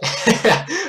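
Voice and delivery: short high laugh; real high-pitched chuckle. Real, not acted. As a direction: crack up at your own bit. Cracks up at own bit.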